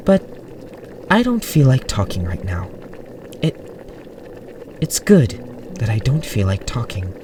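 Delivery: mildly scared, hesitant aunt; frightened. A narrator's reading. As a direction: falter and correct yourself fearfully. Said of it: "a fright in her pale eyes".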